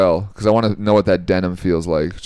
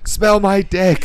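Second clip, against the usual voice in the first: high comic shout; 11 semitones higher, slower than usual. Pretend. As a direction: yell it up high. Joking courtroom bit, shouted.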